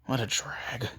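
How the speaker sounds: sigh, then whisper it slowly; lazy whispered grumble; bored sighing whisper. Acted. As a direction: breathe it out like you can't be bothered. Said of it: "And Shikamaru, of course".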